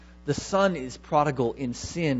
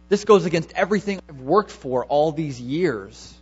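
louder, indignant rant with "hookers" hit hard; the older son venting to his father, bitterly; bitter complaint; 3 semitones higher, 6 dB louder than usual. Retold or imitated. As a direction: vent years of resentment at the father. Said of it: "his message is very angry, it's very bitter".

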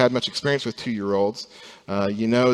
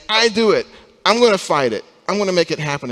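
put-on higher, louder childish insistence; toddler-like insisting; 8 semitones higher, 5 dB louder, faster than usual. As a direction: mimic a stubborn child who wants to do it alone. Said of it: "and we say".

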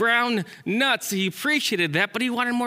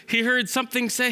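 ordinary delivery

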